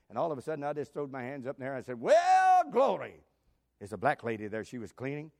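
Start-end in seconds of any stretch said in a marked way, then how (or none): 2.0-3.2 high, loud burst of praise; joyful hollered glory; retold shout. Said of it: "What are you shouting about?".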